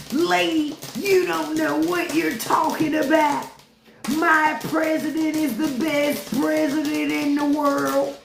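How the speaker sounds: mocking a Trump supporter. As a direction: parody a fan defending his president.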